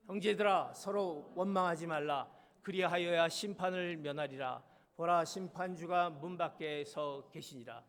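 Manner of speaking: slow, weighty reading of the verse; solemn scripture reading. Acted. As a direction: lay each phrase of scripture down slowly. Reads the scripture aloud.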